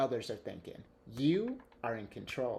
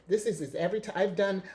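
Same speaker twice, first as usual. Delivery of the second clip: normal talking voice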